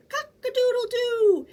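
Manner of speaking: high, loud rooster crow. Acted. Flustered fowl crowing at the sneeze, loud and high. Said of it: "who crowed".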